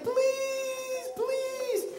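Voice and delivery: very high, soft drawn-out plea; mock imploring, far up in pitch; acted begging. Officials talking to the king, begging. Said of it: "You have to beg".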